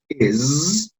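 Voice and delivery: is said softly with a buzzing z, not s; z sound. Shown as a demonstration. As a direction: soften is, ending on z. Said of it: "este sonido de acá es una Z".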